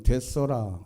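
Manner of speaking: ordinary delivery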